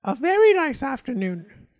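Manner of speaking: high, loud crow voice; talking crow. A narrator's reading. The crow greets the traveller in a high, loud crow voice. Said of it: "that crow answered".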